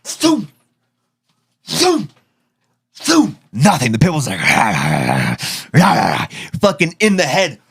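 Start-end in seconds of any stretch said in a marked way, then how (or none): 0.0-0.5 high falling whoosh; imitating dad's shovel swing at the pit bull, high whoosh. 1.7-2.1 high falling whoosh; imitating dad's shovel swing at the pit bull, high whoosh. 3.0-3.4 high falling whoosh; imitating dad's shovel swing at the pit bull, high whoosh. 4.4-6.5 low drawn-out growl; voicing the pit bull shrugging off the hits, low growl; mock dog growl.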